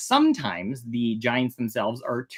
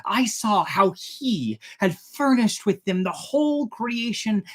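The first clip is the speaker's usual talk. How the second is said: higher, wondering voice recounting heavenly sights; awed Enoch; 8 semitones higher than usual. Acted. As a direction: speak as Enoch marveling at the stars and winds. Reads Enoch's account in a voice.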